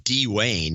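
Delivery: "Dwayne" as two syllables, DEE-wayne. Shown as a demonstration. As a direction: open "Dwayne" with a clear long DEE. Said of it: "it's pronounced Dwayne".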